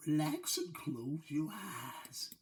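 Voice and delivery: slow soothing hush; sleep video voice. Pretend. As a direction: lull them to sleep, slowly. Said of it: "YouTube sleep videos now".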